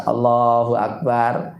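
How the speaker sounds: slow, drawn-out Allahu Akbar in a leading imam's voice; slow chanted takbir like an imam. Shown as a demonstration. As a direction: lead the congregation with the call. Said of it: "Takbirnya suara keras atau pelan? Keras".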